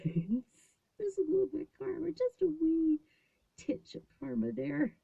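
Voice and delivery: tiny, very high-pitched mock-cute voice; playful understatement. Mother joking about karma with her son, playful high voice. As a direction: squeak it out high, like something teeny.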